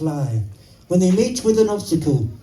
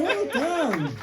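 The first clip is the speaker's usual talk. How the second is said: ordinary delivery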